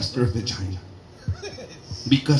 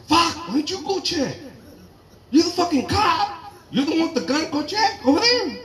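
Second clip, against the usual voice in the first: much higher, frantic whiny pleading; panicky high protest; 12 semitones higher than usual. Retold or imitated. Comic refuses to check the noise, high panicky whine. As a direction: beg her to go instead, scared.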